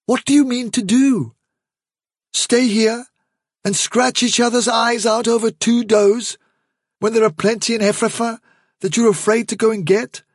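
put-on young leader addressing the warren; Hazel's calm resolve. Hazel voiced.

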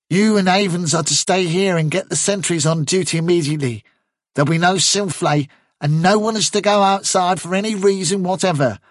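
brief a subordinate with brisk authority; officious rabbit captain. Acted. Chervil giving Bigwig orders after the arrest, officious.

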